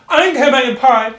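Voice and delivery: high, loud acted teenage outburst; angry teen. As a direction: hurl it out as a stroppy girl. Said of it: "And in her anger".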